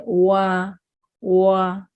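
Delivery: वा as "waa", starting from an O shape; rounded-lip W. Shown as a demonstration. Teacher modelling after explaining lip shape, rounded "waa". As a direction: round both lips, then open into long वा. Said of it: "ओ की तरह".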